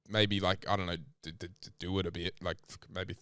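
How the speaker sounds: awkward. Real, not acted. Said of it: "I'm getting so awkward".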